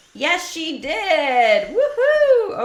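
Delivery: high, loud joyful whoop; cheering. Real, not acted. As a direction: whoop with delight. Celebrating a token drop.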